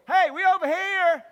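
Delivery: put-on high, loud, laughing kid's shout; excited child calling. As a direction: yell across the room like a thrilled little boy. Boy calling from restaurant corner.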